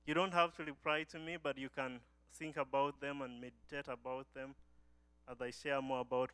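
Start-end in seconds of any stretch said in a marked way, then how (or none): none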